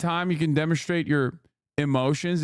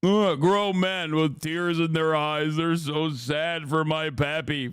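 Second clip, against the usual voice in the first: mocking sneer; slower than usual. Real, not acted. Mocking grown men crying.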